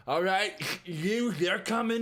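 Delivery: loud, high, drawn-out call; single out prisoners like a loud guard. Acted. Guard calling out prisoners in game.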